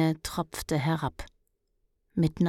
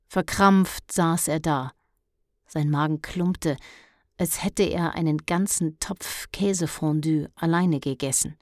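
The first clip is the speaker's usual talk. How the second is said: ordinary delivery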